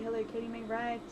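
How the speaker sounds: ordinary delivery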